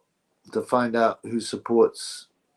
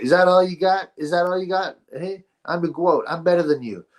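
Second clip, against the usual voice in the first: mock taunting boxer; 6 semitones higher than usual. Mimicking the female champion taunting the guy, high and cocky. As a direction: brag like a fighter mocking her opponent. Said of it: "She was like".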